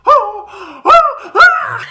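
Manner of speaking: loud, high imitated shrieks of panic; mock panicked screams. Coworker Taylor reacts to her jumping up, imitated screams. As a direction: shriek in bursts like a freaking-out coworker. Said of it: "She starts freaking out".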